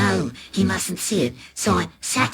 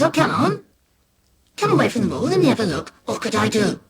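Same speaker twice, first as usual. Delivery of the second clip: higher, fast coaxing dad; cheery dad; 7 semitones higher, faster than usual. Acted. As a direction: coax him away from the wall. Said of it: "Still cheery, he says".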